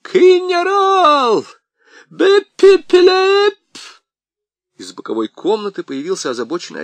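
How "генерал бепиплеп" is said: high frantic scream. A narrator's reading. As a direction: scream desperately, very loud. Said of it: "истошно закричал".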